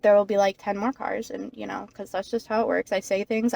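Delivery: ordinary talk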